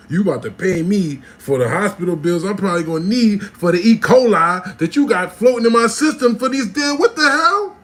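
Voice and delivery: building from firm demand to high, loud yelling; mounting outrage. Acted. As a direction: work yourself up into a comic tirade. Demands cook pay hospital bills.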